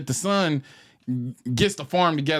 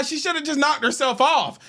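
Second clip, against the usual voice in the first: ordinary talk, nothing marked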